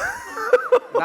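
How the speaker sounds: loud high burst of laughter; real burst of laughing; big laugh. Real, not acted. Laughing at own story.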